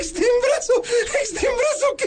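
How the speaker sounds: voz aguda entre carcajadas; riendo burlón. Acted. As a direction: repite el nombre riéndose. Niño se burla riendo. (burla aguda entre risas)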